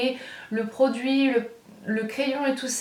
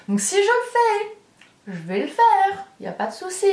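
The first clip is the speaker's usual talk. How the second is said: excited high voice; 9 semitones higher, 3 dB louder than usual. Real, not acted. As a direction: pitch jumps way up, bubbly. Playful excited promise.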